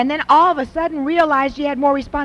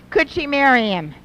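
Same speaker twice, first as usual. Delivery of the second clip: ordinary talk, nothing marked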